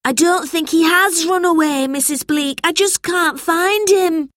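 put-on high, distressed boy pleading; high-pitched plea, slowing at the end; upset cry. A narrator's reading. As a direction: protest in a worried child's voice. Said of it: "cried George".

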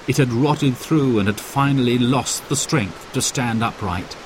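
usual speaking voice

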